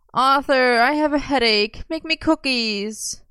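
high-pitched whine, stretched at the end; needy, drawn-out complaint; complaining. A narrator's reading. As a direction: whinge it high, dragging out the plea. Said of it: "Merlin complained".

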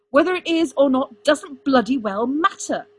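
heated, emphatic exasperation; exasperated. A narrator's reading. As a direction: overrule with angry force. Arthur overrides Merlin in quarrel.

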